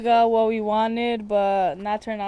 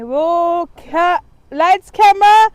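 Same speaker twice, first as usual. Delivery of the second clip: movie director call; 7 semitones higher, 13 dB louder, slower than usual. Pretend. Playful film director call.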